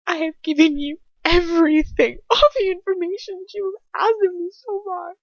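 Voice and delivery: high, loud, crying protest; tearful defiance. A narrator's reading. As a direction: plead and refuse through tears. Tortured victim pleads with captor.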